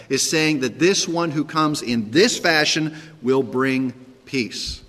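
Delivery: ordinary talk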